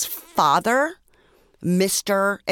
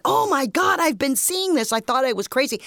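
much higher, amazed exclaiming; excited shock; 7 semitones higher than usual. Retold or imitated. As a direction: gasp it out in disbelief. Everyone stood up, exclaiming.

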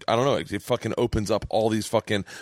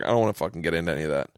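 fast dismissal; 8 semitones lower, much faster than usual. Retold or imitated. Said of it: "I was like".

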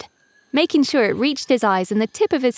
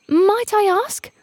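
much higher, slow and careful; a bird venturing a question; tentative cuckoo; 12 semitones higher, slower than usual. A narrator's reading. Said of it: "ventured the cuckoo".

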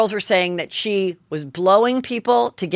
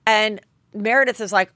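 usual speaking voice

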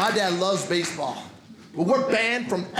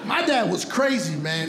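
ordinary talk, nothing marked

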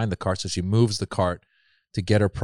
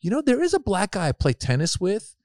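higher, lightly voiced clueless friend; imitating friend; 9 semitones higher than usual. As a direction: play the friend who misses the point.

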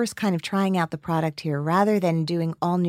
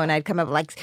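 ordinary talk, nothing marked

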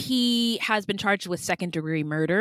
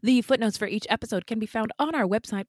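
normal talking voice

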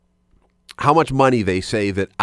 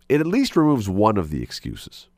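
usual speaking voice

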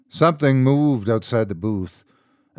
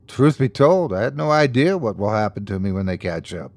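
plain reading voice

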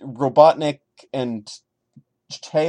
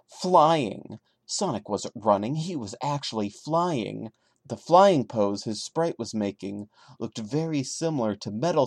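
story narration; 3 dB quieter than usual. A narrator's reading. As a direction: tell the creepy tale steadily. Reads the creepypasta aloud.

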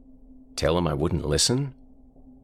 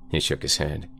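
ordinary delivery

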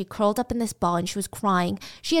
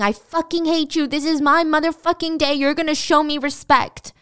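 furious yelling, pitched up and louder; screaming abuse at her; retold scream; 6 semitones higher, 5 dB louder than usual. Boyfriend screaming at girlfriend, furious yell. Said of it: "while screaming".

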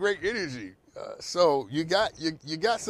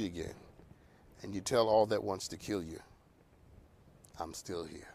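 hushed preaching; 6 semitones lower, 7 dB quieter than usual. Acted. Sermon-like quiet build.

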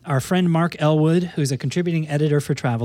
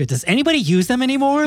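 pitched way up, weary disbelief at guidebooks; exasperated question; 9 semitones higher than usual. Pretend. Host voicing Meredith's weekly question with exasperation. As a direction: ask it like you've given up on them.

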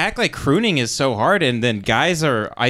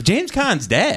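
high-pitched retold disbelief; retold surprise; 9 semitones higher, slower than usual. Reacting to news of a dead actor's post, surprised. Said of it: "And I was like".

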